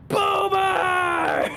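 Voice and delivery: very high, loud anguished scream; devastating cry out. Retold or imitated. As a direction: scream the name as if bereft. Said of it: "Like, devastating cry out".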